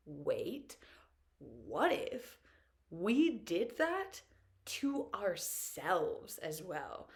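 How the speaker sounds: slow, drawn-out dawning thought; slow realization. Retold or imitated. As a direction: let the idea dawn word by word. Brain's sudden realization.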